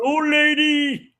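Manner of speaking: a high, slow, drawn-out giant's greeting; Andre's gentle giant greeting. Retold or imitated. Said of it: "Charlie said".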